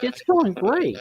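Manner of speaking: high, cheery retold reply; mock-chipper student reply; upbeat answer. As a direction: answer brightly with a big pitch lift.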